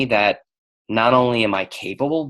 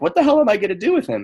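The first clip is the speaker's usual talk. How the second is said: high, fast panicked question; 13 semitones higher, much faster than usual. Retold or imitated. Asking Dane what to do, high, fast and panicked. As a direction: blurt it out in a rush of panic.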